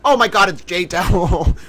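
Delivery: ordinary delivery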